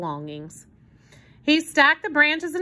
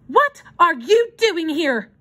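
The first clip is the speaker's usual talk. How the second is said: sharp, louder challenge, slowed down; demanding; 7 semitones higher, 6 dB louder, slower than usual. A narrator's reading. The mother confronting the hobo, sharp and demanding. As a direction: demand an answer with an edge. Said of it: "she demanded of the hobo".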